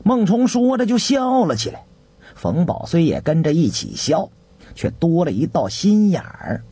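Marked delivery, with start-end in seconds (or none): none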